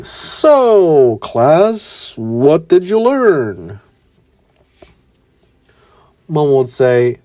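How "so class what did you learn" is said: Alma teasing the class. Acted. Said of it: "Alma would say".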